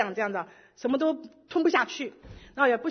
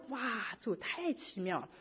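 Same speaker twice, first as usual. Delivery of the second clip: hushed awe; 12 dB quieter, slower than usual. Retold or imitated. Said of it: "我说".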